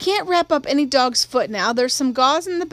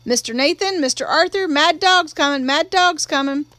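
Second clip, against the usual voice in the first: higher, raised, urgent alarm call; shouted warning; 4 semitones higher, 3 dB louder than usual. A narrator's reading. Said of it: "and she shouted".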